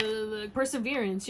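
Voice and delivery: normal talking voice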